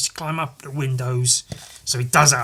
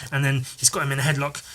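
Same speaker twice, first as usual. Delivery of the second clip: usual speaking voice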